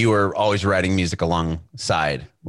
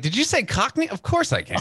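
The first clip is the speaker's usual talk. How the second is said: put-on high-pitched, cocksure kid voice; eager twelve-year-old; 14 semitones higher than usual. Interviewer playing the young auditioner asked for Cockney, eager and high-pitched. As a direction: pipe up like an overconfident child. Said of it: "my twelve-year-old body was like".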